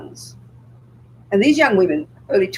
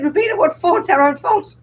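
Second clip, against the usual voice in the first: high, light and casual reply; casual Tibetan friend; 10 semitones higher than usual. Retold or imitated. A friend answers Robina's question, casual and high. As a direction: toss it off easily, with a half laugh. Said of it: "he very casually answered, he laughed".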